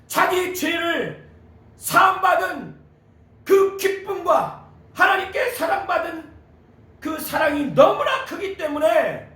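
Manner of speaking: high, emphatic sermon delivery; impassioned preaching. Real, not acted. Preacher explaining the woman's love for Jesus, impassioned. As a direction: drive each phrase home from the pulpit.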